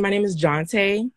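Jonte said slowly as zhon-TAY; name pronunciation. Shown as a demonstration. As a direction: stretch out Jonte, stress on the end. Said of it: "I want you to say my name right".

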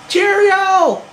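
squeaky high goodbye with a laugh; high pet talk. Real, not acted. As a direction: chirp it to the pet.

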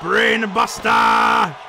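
slow drawn-out shout; shouted move call. Real, not acted. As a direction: belt out the move name. Calls big move.

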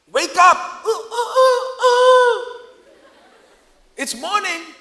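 David mockingly waking Saul from the hilltop; shouted wake-up call. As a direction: yell across the hills like a rooster. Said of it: "he shouts".

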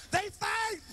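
high, loud shouted announcement. Retold or imitated. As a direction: yell it out to the whole block.

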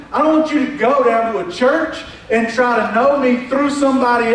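high, loud, impassioned preacher voice; full-force preaching. Acted. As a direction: drive it home to the congregation.

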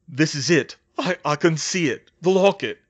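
strained, urgent realization; strained discovery. A narrator's reading. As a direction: stammer out what you see. Harry gasping as he spots the locket.

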